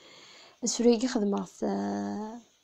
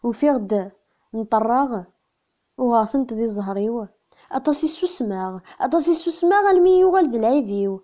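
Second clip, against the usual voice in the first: poem recitation; 7 dB louder than usual. Acted. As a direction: declaim line by line, easing off on the last refrain. (taɣuri n usefru s lǧehd)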